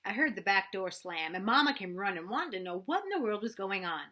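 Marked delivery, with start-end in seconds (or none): none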